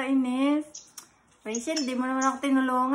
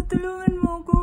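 gasping fake sobs for help; fake crying; 5 semitones higher than usual. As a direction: pretend to be crying and scared. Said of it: "I thought you were crying".

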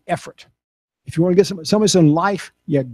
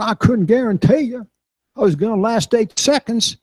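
higher imitation of a Texas cowboy; higher bull rider voice; Ty Murray's voice; 6 semitones higher than usual. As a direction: imitate Ty's earnest cowboy talk. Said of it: "this is how he talks".

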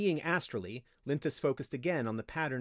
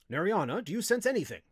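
loud, raised, insistent questioning; demanding; 6 semitones higher, 6 dB louder than usual. A narrator's reading. Wizard demanding what the slave senses, insistently. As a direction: press hard for an answer. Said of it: "Noraim demanded".

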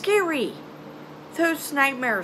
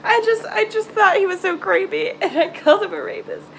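nervous laugh; 6 semitones higher, 4 dB louder than usual. Real, not acted. Laughing while confessing.

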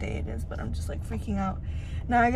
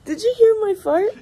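much higher, loud giggling question; laughing; 10 semitones higher, 10 dB louder than usual. Real, not acted. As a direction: laugh through the words.

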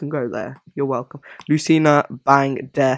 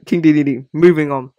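ordinary talk

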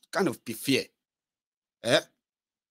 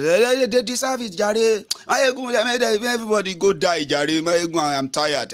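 higher-pitched imitation of someone giving up; mock fed-up follower; 5 semitones higher than usual. Follower telling Mayegun everybody can go die, mock fed-up. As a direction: imitate a weary complainer, pitched up. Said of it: "And he said".

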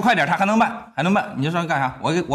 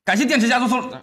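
excited shout-out; 8 semitones higher, 5 dB louder than usual. Acted. Loud mock thanks to gifters.

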